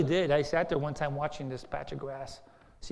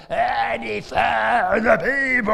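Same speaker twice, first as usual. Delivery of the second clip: slow, loud booming defiance; Goliath's shout; 4 semitones higher, 10 dB louder, much slower than usual. Retold or imitated. Goliath taunting Israel for forty days, slow loud shout. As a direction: bellow it like the giant taunting Israel. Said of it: "he got up and he said".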